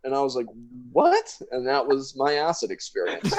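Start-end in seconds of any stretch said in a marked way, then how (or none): 0.9-1.4 very high, sharp rising what; baffled what. Retold or imitated. Friend baffled by Marley's reply, very high what. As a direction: squeak it out in disbelief.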